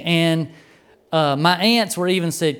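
ordinary talk

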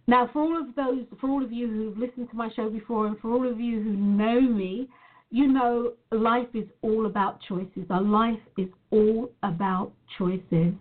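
normal talking voice

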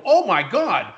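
high-pitched "can't believe it" reaction; retold amazement. Investor reacting to how big the market is, amazed. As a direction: act out being bowled over. Head spinning at the news.

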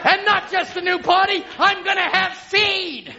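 preaching shout. Real, not acted. Preacher shouting a promise to the church. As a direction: stretch it out, shouting to the rafters.

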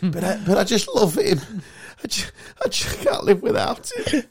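mock tearful, pleading and breathy, rising high at the end; soap-opera wife. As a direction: ham up a lovesick soap wife. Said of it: "like a Coronation Street sketch".

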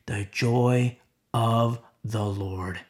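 drawn-out solemn landing; slow, savoured phrase. Real, not acted. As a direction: stretch each word reverently. Slow climax after rapid list.

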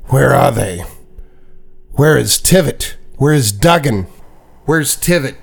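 acted anguish, rising on each question; angry demand. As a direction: demand answers as a furious bereaved husband. Acted furious husband demanding his missing family.

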